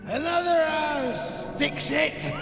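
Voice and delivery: high, drawn-out jeering yell; taunting shout. Acted. Overseer jeers at slaves, taunting shout. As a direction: holler it at the workers with relish.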